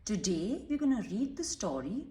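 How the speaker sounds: usual speaking voice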